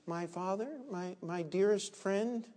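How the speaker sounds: grovel in a hushed, pleading voice; mock groveling servant. Servants pleading with their general, soft mock groveling. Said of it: "look at how they are groveling".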